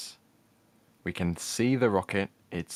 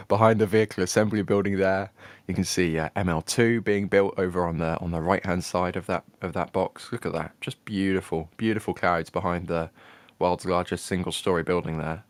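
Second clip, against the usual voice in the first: normal talking voice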